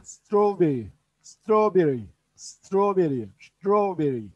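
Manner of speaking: Strawberry repeated with a soft, lisped T. Shown as a demonstration. Teacher drilling Strawberry for Urdu class, T softened. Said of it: "T'yi peltek söylüyoruz".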